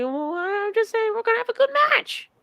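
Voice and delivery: squeaky, very high innocent voice; a cartoonish high voice acting innocent; mock innocent Kevin Owens. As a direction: play dumb in a high squeak.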